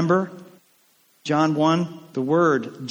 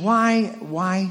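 ordinary talk, nothing marked

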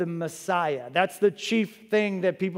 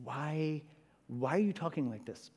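hushed, hesitant aside in a disciple's role; confidential psst; 8 dB quieter than usual. Acted. Disciples tugging at Jesus' robe to question him, hushed. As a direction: softly get the teacher's attention. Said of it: "tugging at his robe, saying".

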